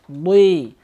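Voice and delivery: "Ды" with a heavy, hard d; emphatic hard consonant in "Ды". Shown as a demonstration. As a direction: press out a firm, loud "Ды". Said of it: "она читается твердо".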